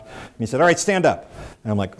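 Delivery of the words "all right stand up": high, loud military order; barked command. Retold or imitated. Lance corporal ends the squat and cough, shouted order. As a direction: order the prisoner briskly up.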